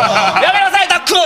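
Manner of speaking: hammed-up dad yelling at kid; middle-aged man yelling. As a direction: call out to a son in a panic. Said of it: "ってお前なってるんですよ".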